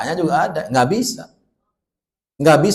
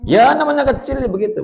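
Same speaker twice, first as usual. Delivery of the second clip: much higher, louder reassurance; emphatic reassurance; 12 semitones higher, 5 dB louder than usual. Real, not acted. Preacher reassures mothers about small children, emphatic.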